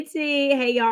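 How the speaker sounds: ordinary talk, nothing marked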